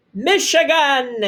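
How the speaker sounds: slow, stretched proud crow. A narrator's reading. Father naming their new home, slow proud crow. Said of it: "he crowed".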